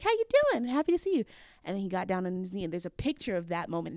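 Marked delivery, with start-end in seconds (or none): 0.0-1.2 higher, louder, sing-song hello; excited greeting. Retold or imitated. Greeting her boyfriend at the party, excited. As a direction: greet your man brightly. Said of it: "I was like".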